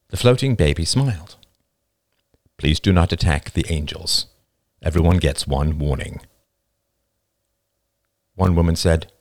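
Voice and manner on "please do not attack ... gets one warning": lower, unruffled warning; impassive angel. Acted. Angel warns the man who leapt, impassive. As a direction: deliver the warning evenly.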